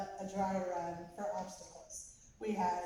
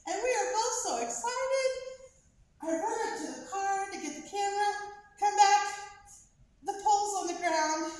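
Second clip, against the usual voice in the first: high, quick and loud with excitement; breathless excitement; 10 semitones higher, 5 dB louder, faster than usual. Real, not acted. Mom recalling running for the camera, high and excited. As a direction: rush through it, still thrilled.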